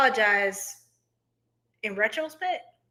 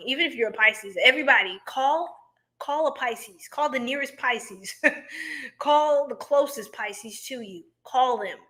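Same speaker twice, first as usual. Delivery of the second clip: ordinary talk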